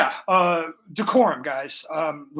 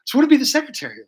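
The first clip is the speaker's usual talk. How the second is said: frustrated whine; 9 semitones higher, 7 dB louder than usual. Retold or imitated. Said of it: "I'm like".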